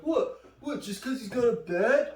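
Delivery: high, loud, indignant imitation of a friend; mock defiant. Drunk teen talking back to a cop, mock indignant.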